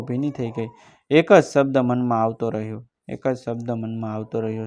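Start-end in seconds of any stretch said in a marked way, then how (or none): 3.1-4.7 softer, sinking echo of the line; softer repeat. A narrator's reading. Narrator repeats the line to himself, softer. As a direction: let the repeat fade inward.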